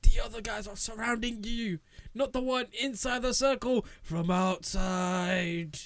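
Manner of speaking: high, drawn-out mock shout; calling out. Warning the man about the guys surrounding him, calling out. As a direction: holler it like a warning from a distance.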